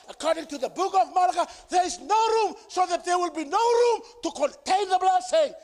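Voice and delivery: loud, high preacher's shout; fervent shouted sermon; preaching at full force. Acted. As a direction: drive the blessing home to the congregation. Preaching climax.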